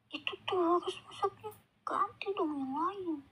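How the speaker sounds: grumpy father. Acted. Father complaining to Mama about dinner, pushy grumble. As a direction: whine at the wife about dinner.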